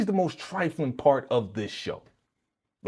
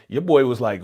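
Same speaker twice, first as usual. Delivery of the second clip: ordinary talk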